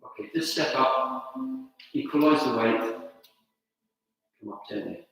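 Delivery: soft, slow calls of each move; calmly name the postures; serene tai chi cues. Acted. Soft cues during form.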